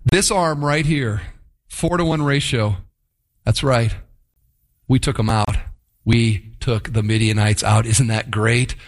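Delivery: low, swaggering brag to the wife; a hint of a low boastful soldier. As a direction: puff up like a proud soldier showing off. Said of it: "gone home to their wives and said".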